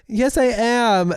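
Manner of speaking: very high, drawn-out mock protest; defiant insistence. Sick partner contradicting a doubting partner, defiant. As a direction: stretch it out, high and petulant.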